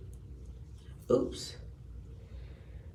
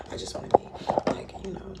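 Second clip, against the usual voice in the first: fast whispered aside, softer; secretive whisper; 4 dB quieter than usual. Real, not acted. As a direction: let it slip as a quick whisper. Aside about celebrities.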